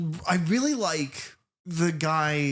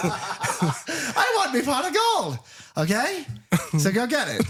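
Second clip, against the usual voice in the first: mock Irish accent; 9 semitones higher than usual. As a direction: imitate a hammy Irish leprechaun, laughing. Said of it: "It's like".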